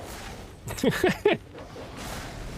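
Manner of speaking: high, airless wheezing giggle; breathless giggle. Real, not acted. Player laughing at his failed escape, wheezing giggle. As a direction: laugh helplessly as the plan falls apart.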